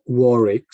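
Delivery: "Warwick" said as WOR-ick, second w silent; "Warwick" pronounced "Worrick". Shown as a demonstration. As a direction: say "Warwick" without its middle w. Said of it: "you don't pronounce the W in the second".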